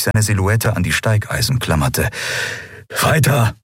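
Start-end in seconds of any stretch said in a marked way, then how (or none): 3.0-3.5 urgent call up the shaft. Acted. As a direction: push him on, sharp and raised. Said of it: "rief er". (drängender Ruf)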